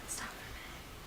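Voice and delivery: sudden hushed whisper after a gasp; breathless hush. Real, not acted. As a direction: gasp and whisper to stop the class. Teacher halts reading suddenly.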